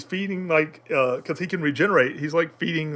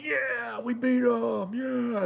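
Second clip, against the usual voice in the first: higher-pitched cheer of the winners, with a shout; mock victory cheer; 8 semitones higher, 5 dB quieter, much slower than usual. Winning army cheering after the battle, excited shout. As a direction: whoop it up like winning soldiers.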